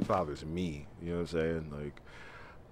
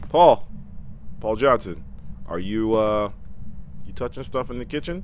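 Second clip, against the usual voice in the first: loud, much higher calls; calling out; 4 semitones higher, 8 dB louder than usual. Real, not acted. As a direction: call into the dark house. Calling ghost loudly.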